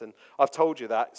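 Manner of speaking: ordinary delivery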